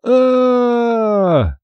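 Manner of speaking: put-on long, high-pitched child's wail; sustained howl falling at the end; heart-rending wail. A narrator's reading. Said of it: "издал душераздирающий вопль".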